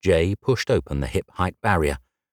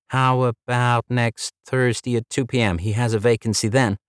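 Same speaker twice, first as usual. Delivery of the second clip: lightly voiced polite receptionist, higher; a hint of a bright front-desk girl, pitched up; 5 semitones higher than usual. Red-haired receptionist greeting Jay, lightly higher polite voice.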